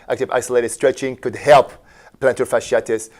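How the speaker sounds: ordinary talk, nothing marked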